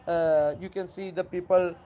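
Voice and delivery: ordinary delivery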